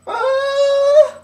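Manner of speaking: sudden high, loud yelp of panic; a shrill, loud cry of alarm; panicked yelp. Retold or imitated. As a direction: yelp out in a flash of fear. Panics at the question.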